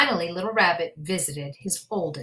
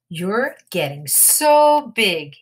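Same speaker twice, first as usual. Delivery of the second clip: tender pride; 3 semitones higher, 8 dB louder, much slower than usual. Acted. Mother proud of son.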